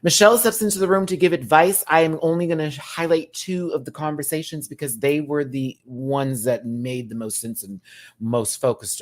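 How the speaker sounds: ordinary delivery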